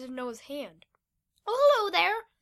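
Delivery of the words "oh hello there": loud, high squeaky greeting; small creature's high voice; high-pitched little creature. A narrator's reading. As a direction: pipe up like a tiny creature. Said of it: "said in a high-pitched voice".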